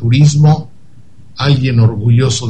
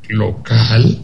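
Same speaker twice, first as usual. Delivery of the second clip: "¿Local?" drawn into a rising question; incredulous, dismissive question; 3 semitones lower than usual. Retold or imitated. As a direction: echo "¿Local?" as if it were absurd. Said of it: "La pregunta y el tono quiere decir, "No jodas". («¿Local?» en tono de pregunta incrédula y despectiva)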